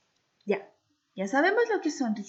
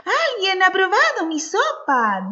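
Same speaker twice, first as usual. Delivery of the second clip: higher, gentle mother bear; mama bear's soft voice; 9 semitones higher, 9 dB louder than usual. Acted. As mama bear noticing her soup, soft motherly voice. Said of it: "dijo mamá osa con voz suave".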